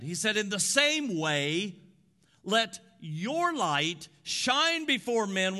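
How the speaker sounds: loud, slow preaching with emphasis; preaching at full force. Acted. Pastor preaching the lamp passage, emphatic preaching. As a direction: drive each phrase home.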